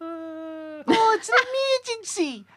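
high, hammed-up cry of alarm; high-pitched mock panic; panicked cartoon caller. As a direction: wail it like a cartoon emergency call. Quotes The Simpsons in mock panic.

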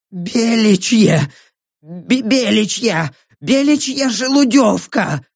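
high, strained words in broken bursts; agonised confession. Acted. Dying prisoner confesses and begs death, straining. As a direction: force out each phrase through pain.

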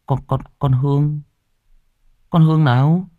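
high, stammered disbelief; shocked stammer. Acted. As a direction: stumble on the name, then question it. Shocked at hearing the dead girl named.

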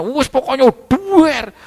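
high, drawn-out crash noise; mimicked explosive outburst.

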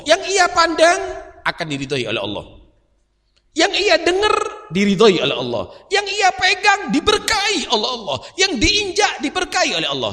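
high, loud, soaring sermon cadence; preaching at full force. Acted. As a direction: lift each line up to the crowd. Preacher's building litany.

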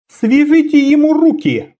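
high ringing voice. Acted. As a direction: ring it out high and cold. Assassin's voice held.

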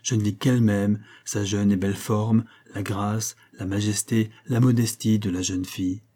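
usual speaking voice

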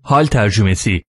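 usual speaking voice

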